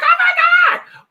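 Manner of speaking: very high, loud, drawn-out screech; shocked scream. Retold or imitated. Retelling the shock of that video call, hammed-up scream. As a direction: scream it out in exaggerated horror. Retold horror at call, phone dropped.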